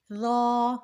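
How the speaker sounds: را as heavy soft th in "this" with rounded "aw"; soft but thick. Shown as a demonstration. Teacher modelling the letter for learners, را as thick dhaw. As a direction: say را as a loud, held "dhaw". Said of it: "پڑھا جائے گا نرمی کے ساتھ".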